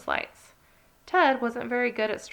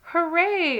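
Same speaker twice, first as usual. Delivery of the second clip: high, loud hooray; cheering shout; 9 semitones higher, 6 dB louder than usual. Acted. Everyone cheering about giving gifts, high and loud. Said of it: "everyone shouted".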